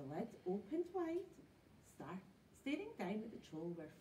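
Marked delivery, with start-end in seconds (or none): none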